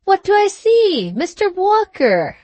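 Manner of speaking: high, delighted cry of recognition; joyful exclamation. A narrator's reading. Trotter feigning a happy meeting with Sam, joyful exclamation. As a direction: exclaim with a start of joy. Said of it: "giving a start of joy, exclaimed".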